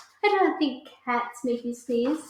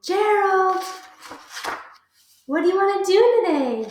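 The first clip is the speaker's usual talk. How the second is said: higher, brighter little pig voice; cheerful pig voice; 6 semitones higher, 5 dB louder than usual. Acted. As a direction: call out to a friend, eager. Piggy voice, higher and louder.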